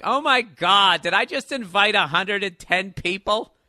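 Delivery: loud, shocked high exclamation; panicked outburst. Retold or imitated. Comic recalls realizing a hundred ten invited, loud panic. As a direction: blurt it out in sudden alarm. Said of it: "And I was just like".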